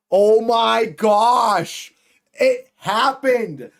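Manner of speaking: slow, drawn-out excited shout; amazed excitement. Real, not acted. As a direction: stretch it out in high, thrilled disbelief. Old storyline has returned.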